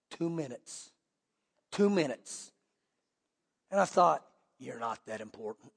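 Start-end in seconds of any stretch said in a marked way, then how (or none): 4.6-5.7 very low, hushed deadpan verdict; private aside. Retold or imitated. Preacher shares what he thought of the Facebook addict, hushed aside. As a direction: drop it to a low undertone.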